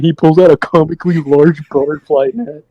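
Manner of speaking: usual speaking voice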